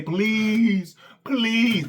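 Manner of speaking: mock beg like a desperate child; begging. Shown as a demonstration. Mocking coach, acts out what real begging sounds like. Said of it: "That's begging".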